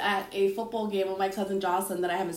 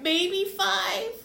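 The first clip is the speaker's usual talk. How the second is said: sheepish guess; 11 semitones higher than usual. Retold or imitated. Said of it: "I said".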